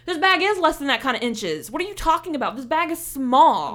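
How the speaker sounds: loud, exasperated protest; heated argument. Retold or imitated. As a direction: push back hard on the guard. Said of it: "arguing with this lady".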